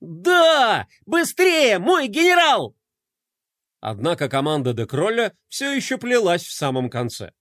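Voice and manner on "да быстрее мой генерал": high, loud gleeful shout; joyful yelling. Acted. Adjutant cheering the general, loud joyful yell. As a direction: cheer it loudly from the sled. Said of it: "радостно вопить".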